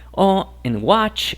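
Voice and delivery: ordinary delivery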